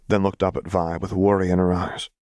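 ordinary delivery

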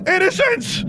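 high scream of a name across the cave; frantic high shout; desperate scream. A narrator's reading. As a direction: scream her name to stop her. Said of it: "Alexandra screamed".

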